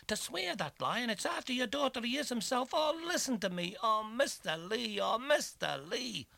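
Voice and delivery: high, loud anguished begging; desperate pleading. A narrator's reading. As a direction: beg through the locked door.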